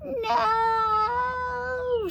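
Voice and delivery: high, long witch wail. A narrator's reading. Witch Beryl wails as the doll is taken. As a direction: howl it out in anguish. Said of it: "she wailed".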